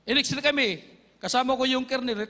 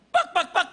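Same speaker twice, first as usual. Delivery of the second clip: sharp, higher, louder bang-bang imitation; mock gunshots; 7 semitones higher, 3 dB louder than usual. Preacher mimics the sniper firing at John, quick loud shots. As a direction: spit out three quick shots.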